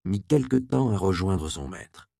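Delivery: usual speaking voice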